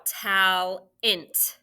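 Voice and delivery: talent, said slowly with a clear "ent" at the end; slow spelling voice. Shown as a demonstration. Teacher sounding out a word for students, slow and careful. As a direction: stretch out talent so the "ent" rings. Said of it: "We say int".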